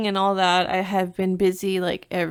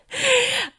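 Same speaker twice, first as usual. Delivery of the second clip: burst of laughter. Real, not acted. Laughs at Chili's invite.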